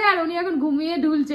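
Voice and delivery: ordinary talk, nothing marked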